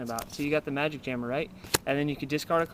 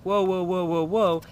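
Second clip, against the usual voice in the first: high, louder alarmed whoas; startled whoa; 4 semitones higher, 4 dB louder, slower than usual. Retold or imitated. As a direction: mimic a startled opponent. Opponent's reaction acted out.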